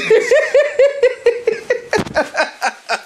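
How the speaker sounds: shrill laughing fit; high shrieking laugh. Real, not acted. After friend's crash.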